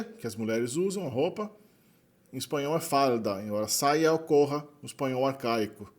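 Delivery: normal talking voice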